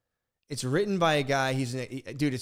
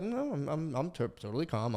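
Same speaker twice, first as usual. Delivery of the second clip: softer, mock-composed denial; feigned calm; 3 semitones higher, 4 dB quieter than usual. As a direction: act composed while seething inside. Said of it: "I'm fucking freaking out inside".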